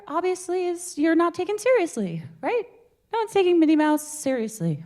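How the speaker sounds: high, light Minnie Mouse-ish air; high airy demo voice. Shown as a demonstration. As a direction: lift it way up high. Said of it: "And air".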